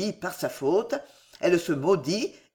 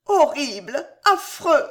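high, distressed moaning cry; anguished moan; 8 semitones higher, 4 dB louder than usual. A narrator's reading. Pale woman reacting to his confession, moaning in horror. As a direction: moan it out in horror, pitched well up. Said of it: "Elle ferma les yeux et gémit".

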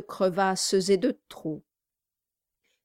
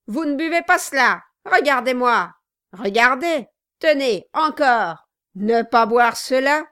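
loud, higher, urgent bursts; boastful showing off; 4 semitones higher, 7 dB louder than usual. A narrator's reading. Host proving he can drink it, loudly boasting. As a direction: crow it out as you show off.

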